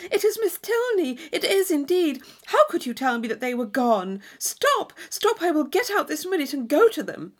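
put-on high, urgent girl's voice; impatient pleading. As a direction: beg him, desperate to get out. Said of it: "she impatiently cried".

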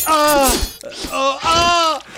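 high mock cry of pain; acted high "ah" of pain. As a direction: yelp as if suddenly hurt. Rory screams each time.

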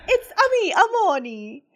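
high Mario-style self-introduction; hint of the plumber greeting; mock Mario voice. Host planning to surprise Leo as Mario. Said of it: "voy a decir".

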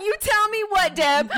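high-pitched playful country twang; teasing country-accent reply; country accent back. Retold or imitated. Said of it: "When she gets into her country accent".

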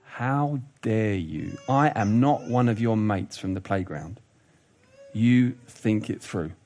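low, slow, soft-spoken indignant parent; controlled parental scolding. Acted. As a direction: draw out each word of the telling-off, keeping anger contained. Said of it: "There comes a point as a parent".

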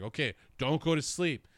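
mock frantic mom warning; worried mom. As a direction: insist he stays awake. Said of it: "And she's just like".

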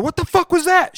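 high-pitched acted outburst; retold alarmed demand. Driver retells asking passenger about the crunch, high outburst. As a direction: demand it, voice shooting up.

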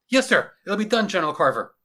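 high, loud, quick reply; obedient lieutenant. Acted. Lieutenant accepting his general's orders, dutiful and quick. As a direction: answer orders with military readiness.

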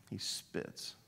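hushed confiding whisper. Retold or imitated. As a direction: whisper it like a secret. Confiding to congregation about son.

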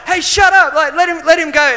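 high, loud protective yell; shouting him down. Retold or imitated. Islander boys telling the heckler to back off, loud shout. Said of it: "they start shouting at him".

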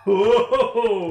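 high, loud whoa through laughter; scandalised laughing yell; laughing shout. Real, not acted. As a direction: burst out laughing in protest. Reacting to the BDSM joke.

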